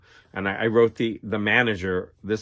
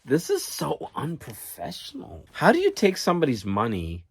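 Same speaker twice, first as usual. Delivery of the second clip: higher, disbelieving scoff; exasperated; 6 semitones higher than usual. Retold or imitated. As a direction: let the disbelief spill out at the unprofessional job. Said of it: "I'm just like".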